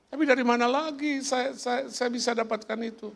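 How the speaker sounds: high mock plea; meniru orang memohon. Meniru permohonan bantuan. (permohonan tiruan bernada tinggi)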